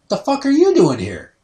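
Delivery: high, loud, incredulous challenge; imitated indignant outburst. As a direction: confront an unwelcome guest, loudly. Former bosses confront him, retold.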